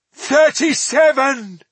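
high, loud, drawn-out cry; anguished shout. A narrator's reading. Elf corrects dwarf's guess at survivors, shouting. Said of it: "shouted Estelin".

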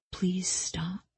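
whispered plea; soft voice. A narrator's reading. Killer quotes the dead woman's plea, whispered. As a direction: drop to a hushed whisper for the quote. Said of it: "before speaking in a soft voice".

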